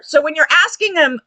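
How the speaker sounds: normal talking voice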